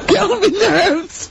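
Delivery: high, slow laughing repeat. Retold or imitated. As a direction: laugh the words out while echoing. Laughing, repeating Carl's phrase.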